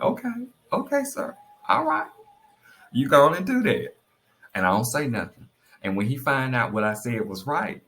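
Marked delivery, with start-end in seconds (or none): 0.0-3.9 high, sing-out mock agreement; mock compliance. Wife humouring husband who won't listen, high-pitched mock compliance.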